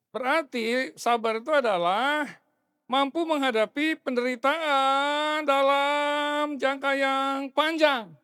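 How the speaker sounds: preaching. Acted. Pastor preaches the meaning of patience to the congregation, forceful and slow. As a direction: drive the definition home from the pulpit.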